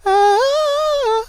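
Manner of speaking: very high held squeal, demonstrated; high gleeful squeal, re-enacted; soft squeal. Shown as a demonstration. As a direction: show the squeal you did in the cinema. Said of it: "you know, very soft".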